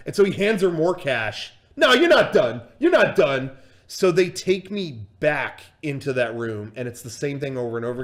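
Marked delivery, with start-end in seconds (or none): 1.8-3.5 loud command, starting high and dropping; imitated boisterous insistence; insistent. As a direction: order it like a pushy buddy. Voices friend insisting loudly.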